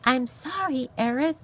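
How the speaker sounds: high, meek apologetic fox; sniveling. A narrator's reading. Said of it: "a voice that was at best sniveling".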